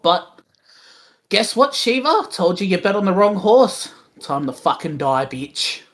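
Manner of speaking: swaggering smug little character voice; smug hero squaring up to an enemy; cocky and smug. Acted. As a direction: deliver it like a cocky taunt. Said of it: "in his cocky, fucking smug little voice".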